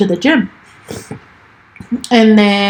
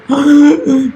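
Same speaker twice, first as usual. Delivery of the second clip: retold gasp; 5 semitones higher than usual. Gasp retelling father's words.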